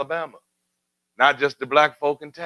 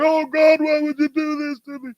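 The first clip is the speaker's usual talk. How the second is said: imitating crying hero; 13 semitones higher than usual. Hero who lost a loved one, mock anguished cry upward. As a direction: play a broken hero crying out to God. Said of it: "they're breaking down and they're crying".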